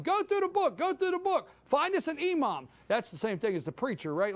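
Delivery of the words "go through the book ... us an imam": high, mocking panicked staffer; mock staffers yelling. Host mocking panicked staffers, high and frantic. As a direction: lampoon the frantic staffers, pitched up. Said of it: "they start yelling".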